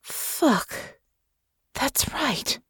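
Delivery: soft, high, breathy realisation; horrified whisper. A narrator's reading. Russo suddenly realising about Natasha's country, horrified whisper. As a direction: whisper it as the horror dawns. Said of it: "she whispered".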